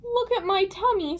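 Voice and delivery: high-pitched lady's voice, in alarm; distressed lady. A narrator's reading. Lady shows Aaron her hairy tummy, distressed. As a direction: complain in a high, upset voice. Upset lady complains about hair.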